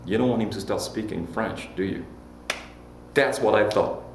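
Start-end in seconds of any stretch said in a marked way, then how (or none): none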